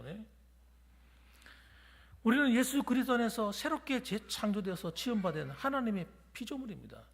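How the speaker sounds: ordinary talk, nothing marked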